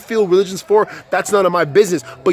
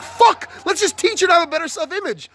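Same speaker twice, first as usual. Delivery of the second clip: scornful retort; 10 semitones higher than usual. Retold or imitated. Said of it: "I'm like".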